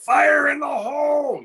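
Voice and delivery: high shouted war cry; screamed callout. Retold or imitated. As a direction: yell it like a battlefield warning. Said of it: "I just scream it as loud as I can".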